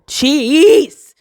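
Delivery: sudden high loud exclamation; exclaimed jeez. Real, not acted. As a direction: burst out with a sharp jeez. Chest tight, exclaims.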